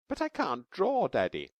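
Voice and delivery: put-on high, bright-eyed child voice, eager; little girl Taffy. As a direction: play a small girl explaining her drawing. Taffy protesting to daddy.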